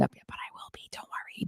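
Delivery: hushed confiding whisper, barely voiced; teasing whisper to the guest; whispered aside. Real, not acted. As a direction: let the listeners half-hear the secret. Playful aside to guest on air.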